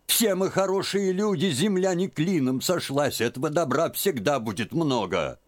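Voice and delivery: put-on high, insistent old woman's scolding; scolding old aunt. A narrator's reading. Aunt scolding Liza for hiding tears, high. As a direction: she won't be fooled.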